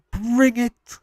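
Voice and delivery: high, squeaky wrestler-style taunt; mock wrestler taunt. Streamer taunting chat in a high mock wrestler voice. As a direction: throw down a silly high challenge.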